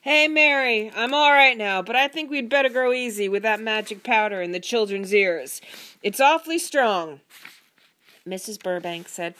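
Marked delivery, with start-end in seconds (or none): 0.0-7.2 loud, raised-pitch call. A narrator's reading. Husband calls to wife from bathroom, loudly. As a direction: project it to someone in another room. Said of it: "Then he called to Missus Burbank".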